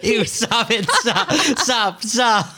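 high, squealing through laughter; real helpless giggly revulsion; laughing protest. Real, not acted. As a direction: beg them to stop while laughing. Laughing helplessly at the crude impression.

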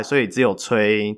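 ordinary delivery

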